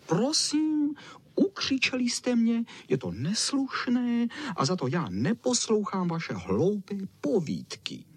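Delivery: very high offended dog; screeching. Acted. Čau Čau refuses to hear their tales, screeching offended. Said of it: "Zaječel Čau Čau".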